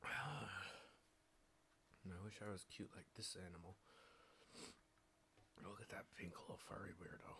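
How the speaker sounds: soft fast whisper to himself. Real, not acted. Looking at a pink furry animal, quick whisper. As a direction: murmur it under your breath.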